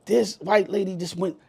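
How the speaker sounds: ordinary talk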